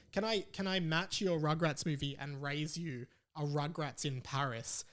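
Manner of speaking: ordinary talk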